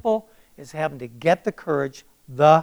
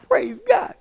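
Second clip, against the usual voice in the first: very high-pitched cry of praise; joyful exclamation; 4 dB louder than usual. Retold or imitated. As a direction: let the praise burst out high. Acts out joy at brother's call.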